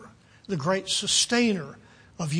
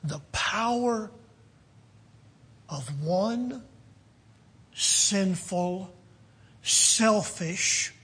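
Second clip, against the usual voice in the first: word by word with long pauses; measured preaching, each word left to hang; deliberate emphasis; much slower than usual. Real, not acted. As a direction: weigh each word for the congregation.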